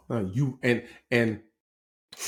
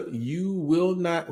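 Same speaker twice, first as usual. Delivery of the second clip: slow, high, drawn-out warning; mock indignant retort; 6 semitones higher, much slower than usual. Teller voices an offended older woman, mock indignant. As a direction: stretch each word out in imitated offence.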